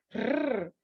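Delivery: a long trilled "rrr" held on the tongue; rolled R. Shown as a demonstration. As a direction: trill "rrr" firmly like the Spanish initial R. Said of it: "ele tem um som diferente". ("rrr" vibrante e prolongado)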